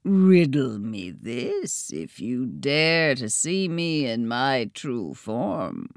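a hidden spirit daring them, low and slow; throaty hidden voice. A narrator's reading. Hidden voice in the trees daring the twins, low throaty. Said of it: "this lone, throaty voice".

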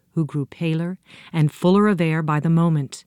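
plain reading voice

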